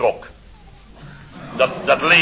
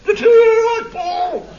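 sarcastic outburst; 8 dB louder, slower than usual. Acted. Sarcastic retort to Paul.

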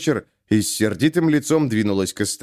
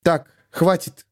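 stern stop; 6 semitones higher, slower than usual. A narrator's reading. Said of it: "Ирина встала перед мужчиной".

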